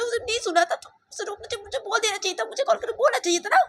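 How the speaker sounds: very high, wailing mother's complaint; close to tears. Acted. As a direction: let the voice climb as the upset mother nears crying. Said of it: "ऐसे बोल के संजीव में से रोने लग जाती है". (रुआँसी माँ की ऊँची आवाज़ में शिकायत)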